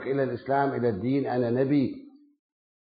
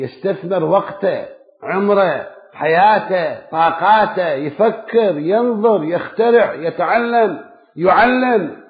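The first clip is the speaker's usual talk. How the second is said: preaching build; 4 semitones higher, 6 dB louder, slower than usual. Acted. Rising list of duties.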